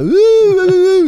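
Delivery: loud high-pitched ouh ouh hoots; high excited hoots. Retold or imitated. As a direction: whoop it up like a cartoon turtle. Mimicking the Ninja Turtles. (cris aigus et forts, façon dessin animé)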